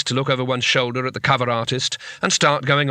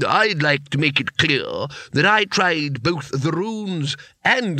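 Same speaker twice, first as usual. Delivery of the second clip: slow, lofty wizard voices, higher; one-upping each other grandly; a hint of pompous old mages; boasting wizards; 3 semitones higher, slower than usual.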